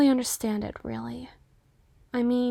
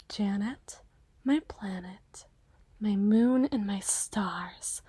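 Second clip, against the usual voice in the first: slow, soft whisper of pet names; whispered endearment; 5 semitones lower, 6 dB quieter, slower than usual. Acted. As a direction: breathe the pet names to her gently. Sweet-talking Janet.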